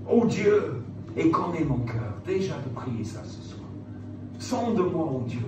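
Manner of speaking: ordinary talk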